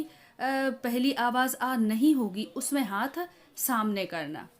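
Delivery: usual speaking voice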